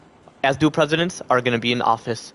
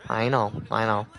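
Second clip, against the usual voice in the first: soft resigned reply; 3 semitones lower, 6 dB quieter than usual. Retold or imitated. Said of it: "And I'll be like".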